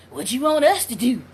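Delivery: low, mildly put-on daughters' question; imitating unbothered daughters. Mother quoting her daughters to viewers, imitating them unbothered. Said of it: "are gonna come talk about".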